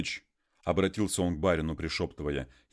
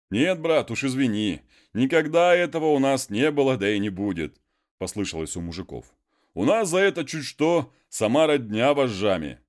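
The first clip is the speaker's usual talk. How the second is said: higher, louder mocking retort; jeering peasants; 8 semitones higher, 7 dB louder than usual. The peasants mock Konstantin, raised and loud. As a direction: laugh him down from the crowd.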